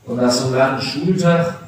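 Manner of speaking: ordinary talk, nothing marked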